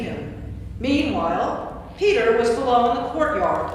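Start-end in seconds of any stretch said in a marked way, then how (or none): none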